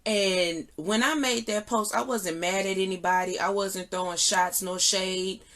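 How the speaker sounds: normal talking voice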